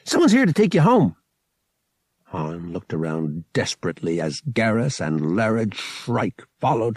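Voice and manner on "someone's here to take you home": loud, high, quick announcement; cheerful. A narrator's reading. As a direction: cheerfully hand him over. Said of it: "he said cheerfully".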